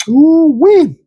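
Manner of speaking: high, loud delighted whoop; excited whoop. Real, not acted. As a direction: cheer like it's the best drink. Excited about blue raspberry Kool-Aid.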